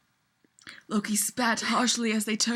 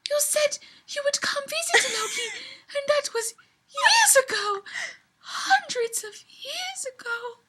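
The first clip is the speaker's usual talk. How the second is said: much higher, pleading elf voice, half laughing; quivering elf woman; 13 semitones higher than usual. Acted. Elf woman reproaching Loki for not visiting, quivering high voice. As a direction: reproach him, voice wavering high. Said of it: "her voice quivering a bit".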